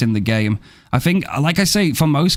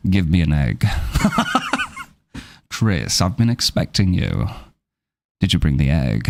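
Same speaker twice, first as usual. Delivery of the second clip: lowered, menacing villain purr, half laughing; mock Wesker villain; 7 semitones lower than usual. Streamer hams up Wesker greeting Chris, lowered villain voice. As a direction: toy with Chris like a smug villain.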